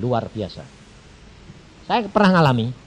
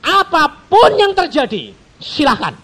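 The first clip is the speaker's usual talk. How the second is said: loud high surrender; 13 semitones higher, 11 dB louder than usual. Acted.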